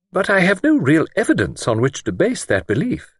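high-pitched, mildly anxious lady; a touch of worry in a high voice. A narrator's reading. Miss Wainfleet admitting she lacks evidence, anxious. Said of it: "Miss Wainfleet explained anxiously".

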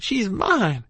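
high-pitched insistent cry; shouted protest. A narrator's reading. Child claiming his ma before the doctor, shouted. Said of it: "I shouted".

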